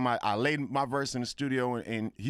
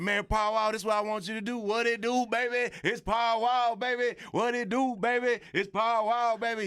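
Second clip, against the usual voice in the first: hyped-up [name]; 9 semitones higher than usual. Retold or imitated. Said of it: "my boy [name] came in there and said".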